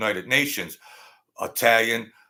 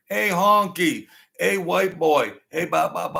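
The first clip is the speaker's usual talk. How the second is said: mock hollered taunts; 7 semitones higher, 3 dB louder than usual. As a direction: taunt the new teacher like a rowdy class. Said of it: "All the students are hollering".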